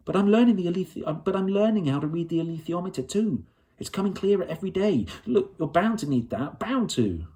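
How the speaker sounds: put-on a girl begging to be taken along; eager pleading girl. Lyra begs John Farr to take her north, eager pleading girl.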